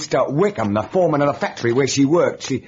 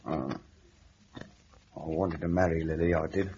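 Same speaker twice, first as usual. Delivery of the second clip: quiet confession; 7 semitones lower, 9 dB quieter than usual. Acted. Lodger confessing to the sergeant, very quiet. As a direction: barely get it out.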